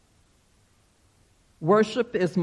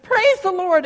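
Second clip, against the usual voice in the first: high, loud burst of praise; ecstatic shout; 12 semitones higher, 6 dB louder than usual. Acted. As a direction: shout it while jumping and clapping. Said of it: "and shouted".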